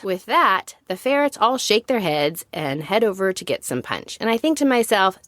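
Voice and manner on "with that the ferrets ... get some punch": usual speaking voice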